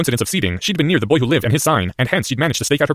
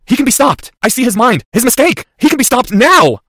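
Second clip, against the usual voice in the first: high, loud, insistent; urgent loud cry; 13 semitones higher, 7 dB louder than usual. Acted. Boy sees the enemy's mistake, urgent cry. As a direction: cry out each short phrase urgently. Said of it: "his voice lower, but still loud enough".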